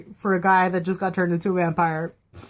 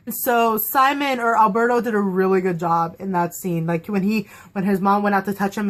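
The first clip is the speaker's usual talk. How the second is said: ordinary talk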